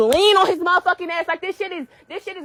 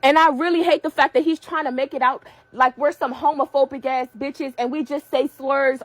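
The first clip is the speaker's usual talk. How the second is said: normal talking voice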